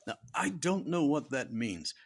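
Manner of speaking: ordinary talk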